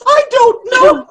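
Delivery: high, loud answer through giggles; giggling. Real, not acted. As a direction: barely get it out for laughing. [name] giggling at friends.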